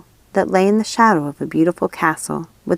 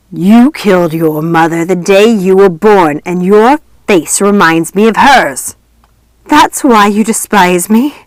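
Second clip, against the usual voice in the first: much louder, slow, cruel ghostly voice; horrible wicked voice; 7 dB louder than usual. A narrator's reading. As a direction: sneer with hatred at her. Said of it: "A horrible, wicked voice".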